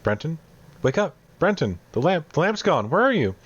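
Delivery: put-on frightened child calling out, high-pitched; small child. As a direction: call for Brenton in a high little child's voice. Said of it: "faint sobs and cries of what appears to be a small child".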